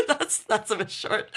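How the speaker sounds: comment while trying to contain laughter; laughing. Real, not acted. Streamer commenting on a seventy-word fanfic, giggling.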